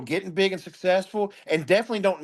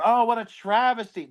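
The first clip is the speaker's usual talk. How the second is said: mocking misuse; 7 semitones higher than usual. Parodying someone calling a house fire a travesty, mock lament. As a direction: ham up the wrong use of travesty. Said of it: "like a house burns down".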